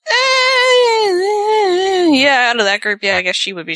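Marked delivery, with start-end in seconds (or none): 0.1-2.1 mock wail. Real, not acted. Reaction to the Charlotte remark.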